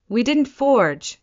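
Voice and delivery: rising, insistent and slow; insist, letting the voice climb. A narrator's reading. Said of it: "voice rising".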